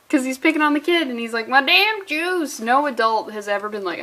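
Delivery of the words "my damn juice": mock whine. Repeats villain's juice complaint.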